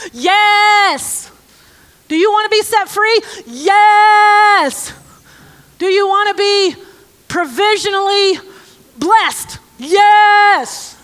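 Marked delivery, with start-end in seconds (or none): 0.1-1.3 emphatic yes. Acted. Calling out the only answer. 3.5-4.9 emphatic yes. Acted. Calling out the only answer. 9.8-11.0 emphatic yes. Acted. Calling out the only answer.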